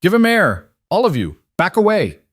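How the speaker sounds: sharp, high commanding shout. A narrator's reading. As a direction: crack the order out like a whip. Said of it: "Adeline's voice cracked like a whip".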